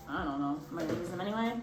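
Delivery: ordinary talk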